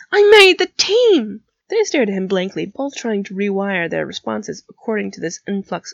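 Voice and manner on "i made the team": loud, high, stretched-out glee; elated slow-stretched cheer; beaming triumph. A narrator's reading. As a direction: crow it proudly, loud and high. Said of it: "he beamed".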